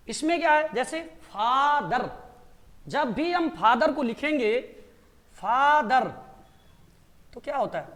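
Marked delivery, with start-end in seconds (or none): 1.2-2.1 "फादर" drawn out as फा, दर; split into syllables. Shown as a demonstration. Teacher showing two syllables, "फादर" stretched as फा-दर. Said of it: "रुकना पड़ता है ना". 5.3-6.1 "फादर" as two beats, long फा then दर; split into syllables. Shown as a demonstration. Teacher counting syllables, "फादर" split as फा-दर. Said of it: "रुकना पड़ता है ना".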